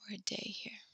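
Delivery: soft unvoiced aside; whisper. Real, not acted. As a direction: whisper it to yourself. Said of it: "whispered aside".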